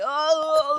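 zombie groan, high and loud. Pretend. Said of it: "Como un zombie".

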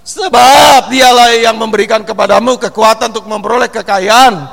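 loud proclaimed scripture; preaching at full force. Acted. Pastor proclaiming the promise of wealth, loudly. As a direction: proclaim the verse to the congregation.

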